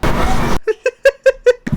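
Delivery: very high squealing laugh; high-pitched giggle fit. Real, not acted. Laughing at own joke.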